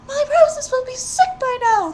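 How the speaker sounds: high, loud alarmed maiden; crying out girl. A narrator's reading. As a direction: cry out in panic for the roses. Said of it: "the girl cried".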